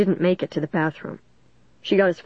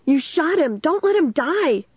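high, distraught wail building higher; 8 semitones higher than usual. A narrator's reading. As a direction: wail it in horror. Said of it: "Caitlin wailed".

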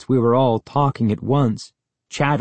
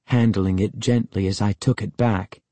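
ordinary delivery, nothing marked

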